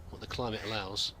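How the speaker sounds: ordinary talk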